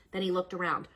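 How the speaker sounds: usual speaking voice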